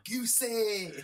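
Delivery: very high mock pub holler; high, drawn-out comic yell; pub shouter. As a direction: call out as if across a pub. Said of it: "That sounds like down the pub".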